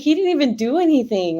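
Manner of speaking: indignant retelling. Retold or imitated. Indignant retelling of own protest.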